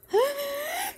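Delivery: high, rising gasp; frightened gasp. Real, not acted. Player startled by Springy, high gasp.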